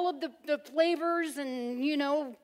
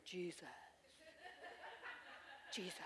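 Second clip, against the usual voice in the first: cool hushed Jesus; 9 semitones lower, 24 dB quieter than usual. Retold or imitated. Imitating Sean's cool, hushed worship. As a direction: breathe the name like a cool worship leader. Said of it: "I could just stand up and go".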